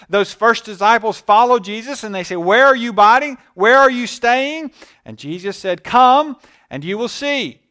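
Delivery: loud, fervent preaching voice; full-force preaching. Acted. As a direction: proclaim it to the congregation. Preaching at full force on come and see.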